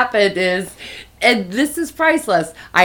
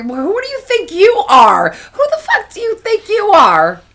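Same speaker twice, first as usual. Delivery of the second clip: mock outraged yell; 12 semitones higher than usual. Mocking Brian, heated retold rant.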